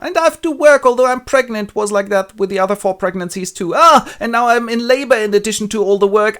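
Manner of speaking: high, loud put-on voice of a fishwife in labor; mother in labor. Fishwife groaning about work while in labor. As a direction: squawk it out like a harried market woman.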